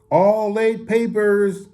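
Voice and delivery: drawn-out, high newsboy call; hawker's shout. A narrator's reading. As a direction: call your wares out to passers-by, high and slow. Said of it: "shouted Mario".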